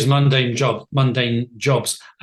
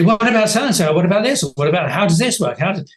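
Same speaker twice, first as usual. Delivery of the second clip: higher, louder mock volley of questions; imitated eager questioning; 7 semitones higher, 4 dB louder, faster than usual. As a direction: fire questions like an eager interviewer. Imitating people firing questions at him.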